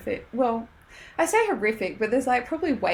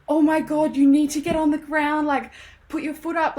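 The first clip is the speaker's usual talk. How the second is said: higher, louder panicked stranger; passer-by freaking out over a bleeding foot, higher and louder; freaked out; 7 semitones higher, 6 dB louder than usual. Retold or imitated. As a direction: blurt it out in a flustered panic. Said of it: "he has totally freaked out".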